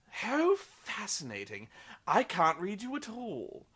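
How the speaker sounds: slow, drawn-out fascinated purr; mock-intrigued vampire, slow and relishing. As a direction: savour every word like a curious old vampire. Vampire finds Bella unreadable.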